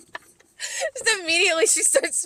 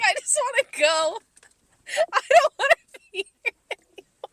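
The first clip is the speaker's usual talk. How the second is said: high-pitched imitated plea, laughing through it; comic, pitched-up wish to escape; mock desperate plea; 10 semitones higher than usual. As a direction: plead to get away, hammed-up. Imitating guard desperate to leave.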